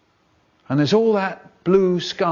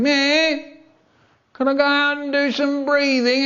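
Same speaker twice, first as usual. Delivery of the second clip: put-on whiny kid; 11 semitones higher than usual. Whiny kid asking teacher to go out, whining. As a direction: plead in a high whiny child's voice. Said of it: "a whiny kid going".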